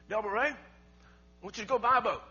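loud imitation of Dad giving orders; father's command. Father instructing his son to buy a boat, commanding.

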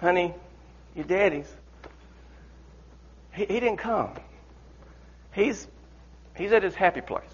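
soft, gentle comfort; mother gently telling the daughter; tender hushed. Acted. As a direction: soothe her gently.

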